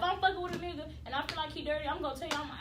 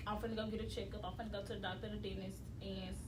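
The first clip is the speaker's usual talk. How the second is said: soft, low, casual suggestion; hushed and easygoing, no accusation; nicest way; 8 semitones lower, 10 dB quieter than usual. Acted. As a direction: put it gently, as if it were routine. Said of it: "nicest way you could put it".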